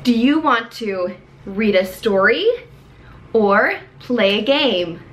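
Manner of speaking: put-on slow and clear, like talking to a young learner; slow teacher voice. Shown as a demonstration. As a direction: spell each word out gently.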